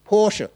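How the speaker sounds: Porsche called out high and loud, PORSH-uh. Shown as a demonstration. Preacher repeats Porsche loudly to the congregation, PORSH-uh. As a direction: push Porsche out with the final uh. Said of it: "So say with me".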